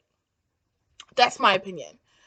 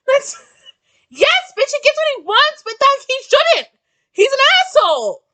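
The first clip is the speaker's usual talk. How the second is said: exasperated rant; 8 semitones higher than usual. Real, not acted. Ranting at the character.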